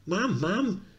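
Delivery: put-on high little boy calling his mum; a kid glad to see his mother; child calling out to his mum; excited young boy. Mickey voiced as child.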